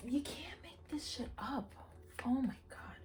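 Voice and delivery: whispered in disbelief; stunned whisper. Real, not acted. Reader whispering in disbelief at her cards. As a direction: breathe it out in awe.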